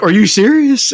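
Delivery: high-pitched imitated disbelief; mock disbelief. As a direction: act out their incredulous look as a question. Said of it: "they looked at me like".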